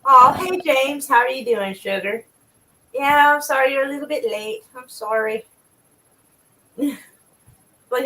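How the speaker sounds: normal talking voice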